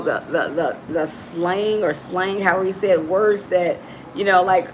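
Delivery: ordinary talk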